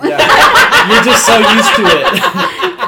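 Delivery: high, loud laughing squeal; laughing hard. Real, not acted. Laughing hard at her friend's southern accent question. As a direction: crack up mid-sentence.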